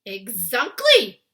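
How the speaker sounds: very loud, slow triumphant cry; cried out. Acted. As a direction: boom it out delighted. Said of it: "cried the B F G".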